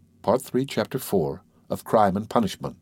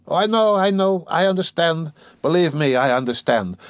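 high start, easing down; insistent, eager to show understanding; hasty agreement; 8 semitones higher, 4 dB louder than usual. Acted. Razumihin assuring his friend he understands, eagerly insistent.

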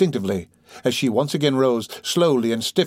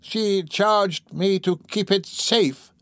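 slow, higher-pitched moaning confession; groaning reply; 9 semitones higher, much slower than usual. Acted. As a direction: groan it out slowly. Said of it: "replied the woman with a groan".

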